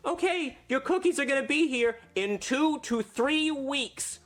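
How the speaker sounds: put-on high, slow little girl voice; sweet Girl Scout, slowly. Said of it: "The Girl Scout says".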